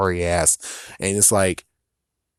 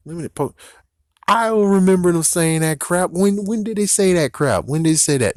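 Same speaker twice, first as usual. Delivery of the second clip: indignant disbelief; 7 semitones higher than usual. Real, not acted. Vlogger scoffing at fans' Pokédex rumour, incredulous. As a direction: demand to know when it was said.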